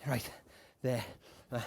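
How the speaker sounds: normal talking voice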